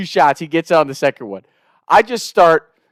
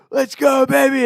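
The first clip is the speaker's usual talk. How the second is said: high, excited cheer at normal volume; imitated celebration; 10 semitones higher than usual. Brothers jumping up and down, excited. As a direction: re-enact a giddy celebration. Celebrating while searching blood trail.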